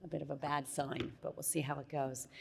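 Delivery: losing voice, rough and hoarse. Real, not acted. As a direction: talk through a fading hoarse voice. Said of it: "I'm losing my voice".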